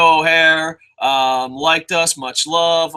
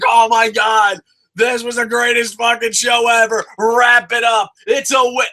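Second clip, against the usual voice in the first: high, loud gushing cheer; thrilled fan yelling; excited shout; 5 semitones higher, 3 dB louder than usual. Retold or imitated. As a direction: cheer like the best show ever. Quoting own cheer after show.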